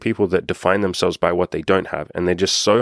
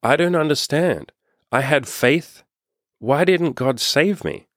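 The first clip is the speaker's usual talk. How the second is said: much higher, bewildered complaint; baffled drowned man; 7 semitones higher, slower than usual. Acted. Drowned man questioning Saint Peter at the gates, higher bewildered voice. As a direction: plead your confusion like a wronged believer. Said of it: "the man says to Saint Peter".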